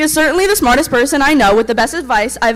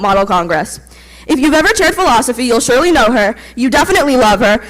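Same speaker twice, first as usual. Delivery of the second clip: usual speaking voice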